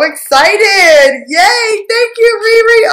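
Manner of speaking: excitement rising into a high squeal; so excited. Real, not acted. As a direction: stretch it out, then squeal your thanks. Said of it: "I am so excited".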